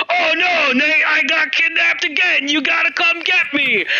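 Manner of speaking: high, whiny, hammed-up Sam voice; whiny Sam impression. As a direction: whine it like a helpless little brother. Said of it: "Sam comes in".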